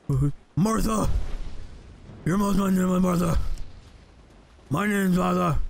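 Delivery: hammed-up Batman growl, slowed; mock superhero gravitas over nothing; gruff mock Batman. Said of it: "talk in the Batman voice".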